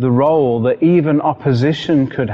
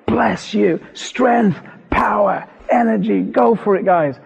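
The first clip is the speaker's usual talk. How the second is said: retold shouting; 7 semitones higher than usual. Shouting encouragement to heaven's sculptors, mock shouting. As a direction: cheer them on in a high, urgent voice. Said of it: "I'm shouting away".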